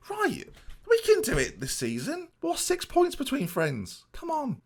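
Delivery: mock pep talk. Pep talk to himself about title.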